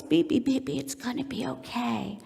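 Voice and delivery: put-on hushed baby comfort, fading to a whisper; soothing tone. Shown as a demonstration. Parent looking into baby's eyes, softly soothing. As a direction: murmur it tenderly to an infant. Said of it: "It's that tone of voice".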